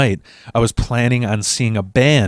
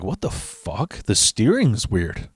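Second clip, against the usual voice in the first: puzzled mutter; 6 dB quieter, slower than usual. Retold or imitated. Driver to himself at the wheel as the steering goes odd, slow quiet mutter. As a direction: mutter it to yourself, baffled and slow. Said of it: "I'm like".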